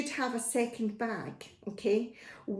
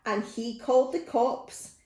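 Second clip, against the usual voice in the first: ordinary delivery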